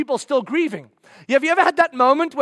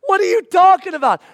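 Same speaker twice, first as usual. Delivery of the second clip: incredulous retort; 6 semitones higher, 6 dB louder than usual. Pretend. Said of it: "the guys are like".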